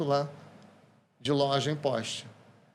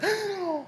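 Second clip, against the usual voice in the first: mock gasp. Waitress reacting to gestures.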